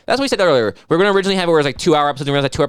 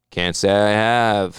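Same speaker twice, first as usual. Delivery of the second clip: imitating Mike; 3 semitones lower, much slower than usual. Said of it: "You ever smoke a blunt?".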